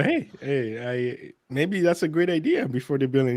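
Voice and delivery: usual speaking voice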